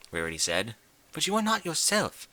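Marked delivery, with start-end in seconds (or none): 1.1-2.3 a light, refined lady voice, higher; lightly voiced refined unicorn; Rarity's voice. A narrator's reading. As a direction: play the prim fashionista gently.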